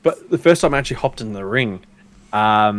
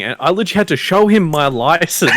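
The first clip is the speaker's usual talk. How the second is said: usual speaking voice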